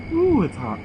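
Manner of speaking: high, sudden ooh at the spice; surprised by heat. Real, not acted. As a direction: react to a burning bite. Reacting to spicy chip.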